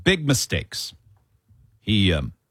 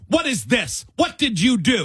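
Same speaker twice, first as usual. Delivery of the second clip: higher, scolding like at a dog; scolding cop; 8 semitones higher than usual. Retold or imitated. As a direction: rub his nose in it. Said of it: "the officer's saying".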